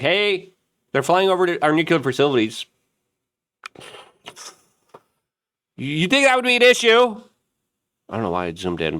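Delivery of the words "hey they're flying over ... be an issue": higher, pointed sarcastic question; mock governor. Governor warning officials about drones, higher sarcastic question. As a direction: needle them with a rising question.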